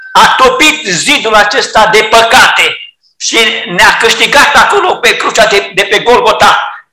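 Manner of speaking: normal talking voice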